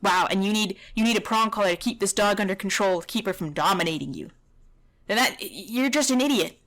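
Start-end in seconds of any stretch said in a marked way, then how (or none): none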